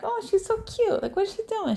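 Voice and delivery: high-pitched doting mom voice; adoring coo. Retold or imitated. Mom retelling her delight at her toddler, high coo. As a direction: coo it high, as at something cute.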